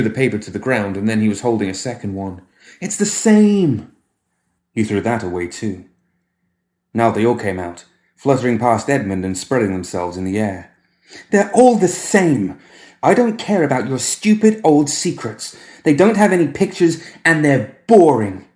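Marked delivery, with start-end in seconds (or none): 2.8-3.9 put-on high, slow, louder complaining boy; whiny child. Boy tossing the paper away, high and slow. 11.3-18.5 put-on high, loud spoilt child's outburst; petulant tantrum. Boy rejecting Edmund's secret papers in a loud tantrum.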